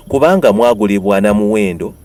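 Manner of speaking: normal talking voice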